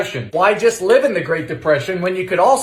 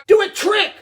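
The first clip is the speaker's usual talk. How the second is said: high, loud playful shout for a trick; excited shout; 12 semitones higher, 4 dB louder than usual. Real, not acted. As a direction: cheer the dough on like a magic show. Cheering on the baking.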